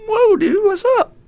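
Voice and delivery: very high, startled reaction; squeaky pretend surprise; mock taken aback. Person startled by the amoeba's rude gesture, mock taken aback.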